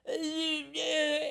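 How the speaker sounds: high, groggy whimpering yeah; a mock stunned answer; dazed drunk. Him answering the crowd, dazed and groggy.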